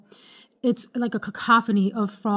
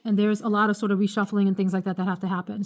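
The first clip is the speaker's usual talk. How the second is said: ordinary talk, nothing marked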